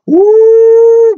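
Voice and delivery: high, loud joyful whoop; a sudden high shout of praise; ecstatic woo. Real, not acted. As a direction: cry out in holy joy.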